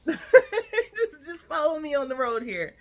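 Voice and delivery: laughing, high and loud. Real, not acted. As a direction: laugh through it as you ask them to go along. Laughs at own tangent.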